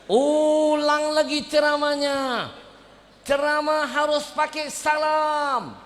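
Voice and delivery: high, loud, slow angry order; teacher yelling. Retold or imitated. Teacher yelling at the student to redo it, angry. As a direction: shout the correction at the student. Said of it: "Guru saya teriak".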